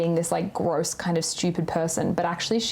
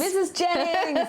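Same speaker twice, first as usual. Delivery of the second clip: high, drawn-out delighted cry of her name; excited squeal; 10 semitones higher, slower than usual. Real, not acted. Fan squealing over her favourite character, delighted.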